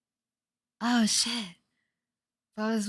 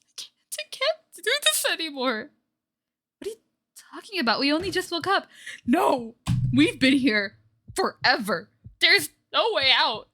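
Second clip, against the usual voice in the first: breaking down; 7 semitones higher, 3 dB louder than usual. Acted. Karma breaking down.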